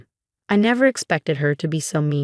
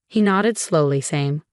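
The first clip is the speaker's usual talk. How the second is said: ordinary delivery, nothing marked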